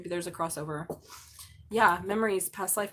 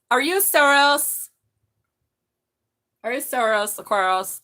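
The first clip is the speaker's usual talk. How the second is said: excited; 4 semitones higher, 9 dB louder than usual. Real, not acted. Excited by the card.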